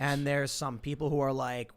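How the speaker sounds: normal talking voice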